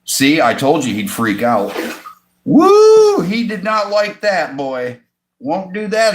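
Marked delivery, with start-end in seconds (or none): 2.4-3.2 sudden high, loud whoop. Real, not acted. Owner whoops as the fish startles. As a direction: yelp it out in surprise.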